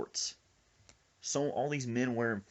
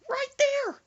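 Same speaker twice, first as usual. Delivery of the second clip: piercing high shout; frightened scream; 8 dB louder than usual. Retold or imitated. Friend points out the snake, screaming in panic. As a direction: shriek it in cartoonish panic.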